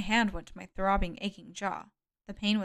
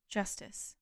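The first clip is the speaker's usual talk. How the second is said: soft, unvoiced-sounding whisper; 8 dB quieter than usual. A narrator's reading. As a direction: whisper it as a solemn verdict. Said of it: "talking in whispers".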